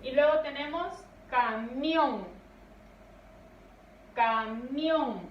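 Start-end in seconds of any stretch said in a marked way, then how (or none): 1.3-2.2 camión drawn out, leaning on the last syllable "mión"; stress on final syllable. Shown as a demonstration. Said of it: "es donde recae la mayor fuerza". 4.1-5.2 camión drawn out, leaning on the last syllable "mión"; stress on final syllable. Shown as a demonstration. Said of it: "es donde recae la mayor fuerza".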